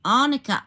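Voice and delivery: Arnica said loud and high with a clear N; correcting herself, Arnica with an N; Arnica, stressing the AR; pronunciation check. Shown as a demonstration. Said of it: "am I pronouncing it wrong?".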